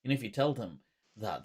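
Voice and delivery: usual speaking voice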